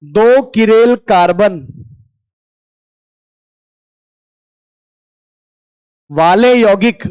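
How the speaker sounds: slow, long pauses between phrases; slow dictation. Shown as a demonstration. Teacher dictates a rule for students to copy, slow. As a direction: dictate word by word for notes. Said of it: "एक word बोल देता हूं यहां पर".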